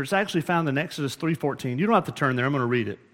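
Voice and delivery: usual speaking voice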